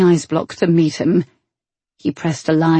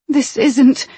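breathless; 8 semitones higher, 3 dB louder than usual. A narrator's reading. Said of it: "her own breathless words".

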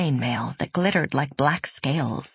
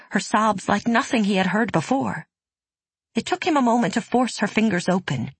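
ordinary delivery, nothing marked

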